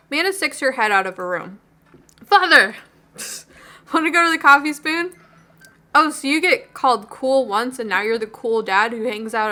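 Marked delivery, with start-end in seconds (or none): 2.2-5.1 higher, louder mock-formal call back; bright reply. Daughter calls back to her dad, higher and louder. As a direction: answer like a playful daughter.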